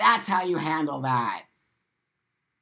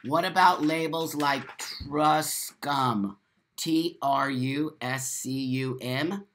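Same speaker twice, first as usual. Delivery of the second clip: slowly reading the comment back; rereading a viewer's question, stunned and slow; slower than usual. Real, not acted. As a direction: spell it out slowly in disbelief. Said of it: "Melody said something to me".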